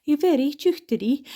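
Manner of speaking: teasing line in a lilting Lewis accent; Stornoway accent. Shown as a demonstration. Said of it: "It's very much like a sing-songy kind of voice".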